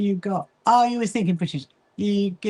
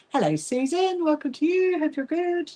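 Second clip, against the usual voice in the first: bright greeting; 9 semitones higher than usual. Real, not acted. Greeting a viewer in chat.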